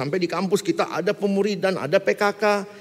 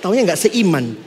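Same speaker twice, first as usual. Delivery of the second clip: ordinary delivery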